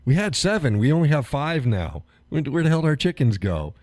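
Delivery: high, loud panicked voice; mock panic. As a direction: play the frantic chicken owner. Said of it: "It's like".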